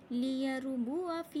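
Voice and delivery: "लियरबुआ फी" slowly chanted, short wa, long fee; silent alif. Shown as a demonstration. Teacher showing students, slow "लियरबुआ फी" with short wa. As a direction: recite "लियरबुआ फी" without stretching the alif. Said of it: "अलिफ मद्दा को नहीं पढ़ा हमने".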